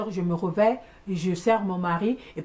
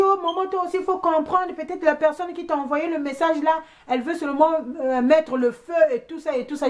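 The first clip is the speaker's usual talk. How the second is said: imitated pleading; 8 semitones higher than usual. Acts other woman's plea for understanding.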